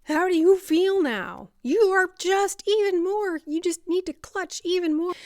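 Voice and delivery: loud, high, insistent outburst; frantic escalation. Acted. Madwoman ignored while trying to protect, frantic.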